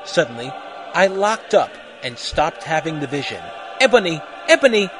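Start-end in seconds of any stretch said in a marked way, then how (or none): 3.8-4.9 loud, higher, urgent question; hammed-up worried voice. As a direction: call out in alarmed concern. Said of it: "in a worried voice".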